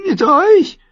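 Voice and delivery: extrem hohe, quiekende Frage; gequiekt. A narrator's reading. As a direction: vor Schreck die Stimme kippen lassen. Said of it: "lösten sich als Quieken". (Sam quiekt ängstlich vor dem Lord Commander)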